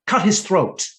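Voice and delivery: queen condemning the master of coin, loud command; cold command. Retold or imitated. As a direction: give the death order out loud. Queen orders a man killed.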